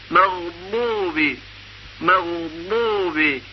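مغضوب drawn out slowly and softly; slow recitation. Shown as a demonstration. Teacher recites from Surah al-Fatiha slowly. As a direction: linger on مغضوب, holding the ض.

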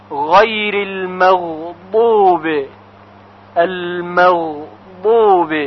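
غیر المغضوب slow with throaty gh and side-tongue ḍad; careful ghayn and ḍad. Shown as a demonstration. Teacher drills المغضوب slowly, part by part. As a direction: slowly drill the word in parts. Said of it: "حرف ضاد".

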